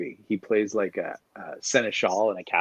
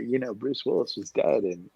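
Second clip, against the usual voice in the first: ordinary talk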